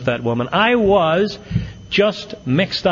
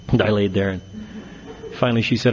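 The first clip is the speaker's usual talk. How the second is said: normal talking voice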